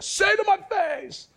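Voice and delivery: very high-pitched imitated yell; mock fighting shout. Grandkids copying grandpa's fighting words, mock shout.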